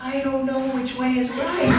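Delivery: put-on high child's cry; panicked little boy. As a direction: answer like a small boy on a runaway sled. Small son panics on sled.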